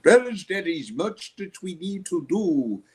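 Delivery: a hint of a Jamaican preacher addressing brothers; Jamaican accent. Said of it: "he had a Jamaican accent".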